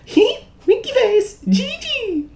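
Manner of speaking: put-on very high, slow squeaky girl voice; a badly faked high girly voice; Diva voice. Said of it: "Can't do her voice".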